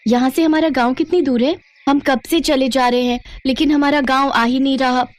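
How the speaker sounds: put-on a lost child asking the way; hungry young girl. Lost girl asking herdsman for her village, high-pitched.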